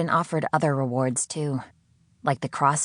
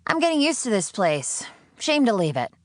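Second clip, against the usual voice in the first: much higher, flippant no; sarcastic refusal; 8 semitones higher than usual. Acted. Sydney refusing her captor, higher flippant sarcasm. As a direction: brush it off.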